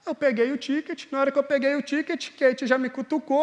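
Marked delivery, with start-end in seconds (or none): none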